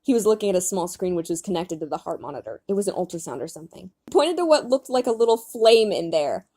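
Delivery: ordinary talk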